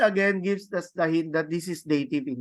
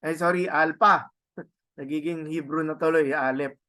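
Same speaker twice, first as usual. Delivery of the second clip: ordinary delivery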